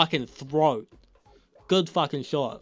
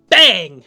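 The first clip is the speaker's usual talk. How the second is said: very loud, sudden mock gunshot "bang"; imitated gunshot; 3 semitones higher, 15 dB louder than usual. Recapping Jesse getting shot, loud mock gunshot. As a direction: shout it like a rifle crack.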